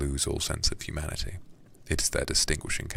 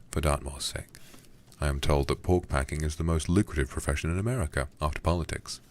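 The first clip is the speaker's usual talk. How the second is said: ordinary delivery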